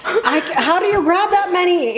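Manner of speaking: high amazed laugh. Real, not acted. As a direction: laugh in disbelief. Amazed at friend's huge scoop.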